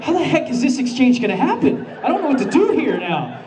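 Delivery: high, laughing panicked questions; comic driver freaking out over a bag of coins; mock panic. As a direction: play the rising panic for laughs, high and breathless. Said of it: "I'm starting to freak out".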